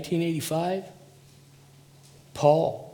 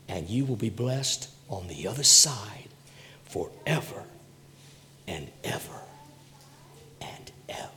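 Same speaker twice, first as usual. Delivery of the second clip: forever and ever fades from soft voice to breathless whisper; fading to a whisper; 5 semitones lower, 9 dB quieter than usual. Acted. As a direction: let the blessing die away into a hushed whisper. Sermon climax fades to whisper.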